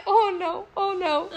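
high, laughing dismay; mock panic. Hearing he got the picture, laughingly retold panic. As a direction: replay the dread through a laugh.

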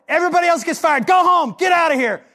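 mock firing shout. Jesus firing everyone off the board.

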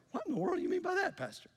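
high, fast mock-baffled church member; puzzled listener. Congregation member baffled by the pastor, high quick mock puzzlement. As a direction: play a confused listener questioning the pastor. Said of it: "some of you are looking at me going".

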